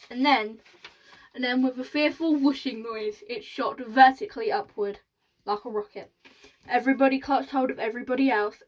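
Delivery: usual speaking voice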